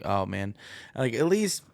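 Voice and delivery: ordinary delivery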